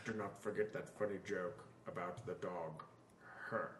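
deadpan Rickman reminder, low and quiet; mock Alan Rickman voicemail, low and soft; Alan Rickman impression. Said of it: "Hello, Alan".

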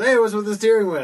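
high, loud, quick question; acted bartender. Bartender questions the pirate about his steering wheel, calling out. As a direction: fire off the bartender's question loudly.

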